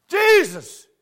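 high, loud cry of the name; calling out. Retold or imitated. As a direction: call out the name to the whole room. Preacher calls the boy out.

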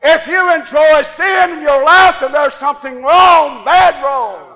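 high, loud sermon shout; full-force preaching. Acted. Preacher warning church against enjoying sin, full-force preaching. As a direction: thunder it out at the congregation.

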